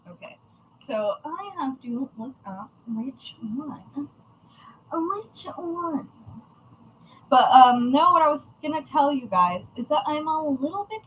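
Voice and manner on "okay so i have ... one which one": whispering to herself. Real, not acted. Muttering while looking something up.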